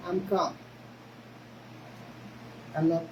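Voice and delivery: usual speaking voice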